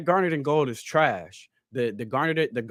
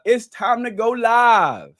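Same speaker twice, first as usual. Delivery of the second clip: loud, high, drawn-out rapper impersonation; 6 semitones higher, 6 dB louder, much slower than usual. Acted. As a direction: stretch it out loud and slow, rapper style. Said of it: "says in my [name] voice".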